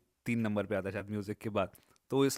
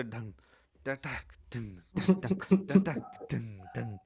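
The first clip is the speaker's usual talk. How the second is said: percussive mouth drum pattern; beatboxed rhythm demo; vocalized beat structure; much slower than usual. Shown as a demonstration. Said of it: "पूरा structure है".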